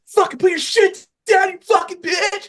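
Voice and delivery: hammed-up screamed threat; mock robber's shout. Imagining holding up the store clerk, mock furious shout.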